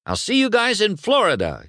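high-pitched called goodbye; shouted farewell. Acted. Lightning to friends before leaving, shouted farewell. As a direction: call out a cheery goodbye to friends. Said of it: "he shouted to his friends".

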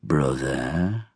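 low, soft address; softly speaking. A narrator's reading. Yeoman answering the summoner's question, softly. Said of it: "softly speaking".

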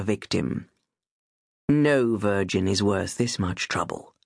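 amused, venomous gentleman, low; menacing stranger. A narrator's reading. The monster mocks the armed girl as he withdraws, lightly low and silky.

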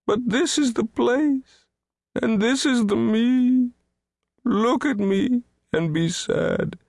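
high, keening self-pity; weeping lament. A narrator's reading. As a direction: beg for pity in a sustained high wail. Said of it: "He wept".

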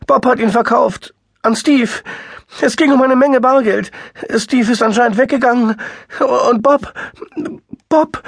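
very high, loud and crying; rising into tears; tearful high voice. A narrator's reading. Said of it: "Ihre Stimme stieg wieder eine Tonlage".

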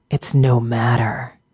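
slow, tired creature rasp; weary orc voice. A narrator's reading. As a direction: brush it off, exhausted. Dying orc speaks.

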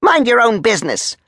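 high, sharp telling-off; angry rebuff. Acted. Major rebuffing the nosy soldier, high and angry. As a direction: shut him down in a high voice.

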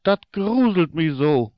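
ordinary delivery, nothing marked

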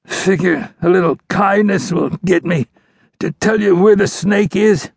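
high, strained dying outlaw voice; pained, scornful outlaw. A narrator's reading. Wounded outlaw suspicious of the ranger's kindness, pained scorn. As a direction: scoff through the pain.

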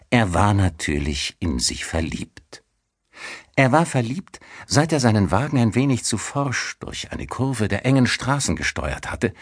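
plain reading voice

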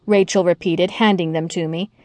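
ordinary delivery, nothing marked

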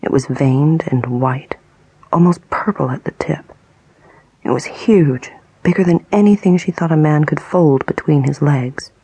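usual speaking voice

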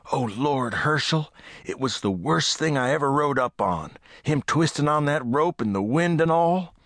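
a hint of a spooked rancher telling the sheriff what he saw; shaken rancher. Rancher tells the sheriff about finding the hanged man, lightly voiced and shaken.

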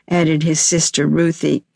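ordinary delivery

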